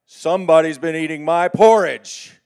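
loud booming papa bear. Acted. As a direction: boom it out like a big bear father. Papa bear voiced in story.

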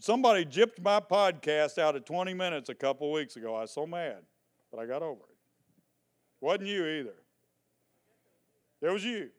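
ordinary talk, nothing marked

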